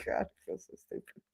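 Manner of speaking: whispered, mortified; embarrassed whisper. Retold or imitated. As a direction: hide the words in a whisper. Embarrassed after asking friend.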